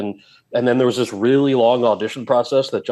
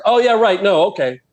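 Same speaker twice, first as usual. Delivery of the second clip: high, louder mock-sarcastic okay; sarcastic acceptance; 8 semitones higher, 3 dB louder than usual. Actor accepting the screenwriter's excuse, mock sarcastic. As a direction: play it like a huffy, sarcastic brush-off. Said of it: "I'm like".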